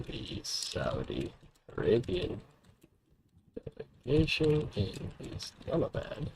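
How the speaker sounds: slow, hushed reading of a news ticker; slow, soft mock news read; headline read. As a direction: read the game headline out like a newsreader. Reads news headline aloud slowly.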